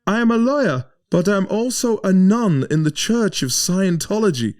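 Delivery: high, loud announcing voice; German passenger taking charge of the stranded bus; German woman taking charge. Retold or imitated. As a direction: address the whole bus like a self-appointed leader. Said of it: "And she went".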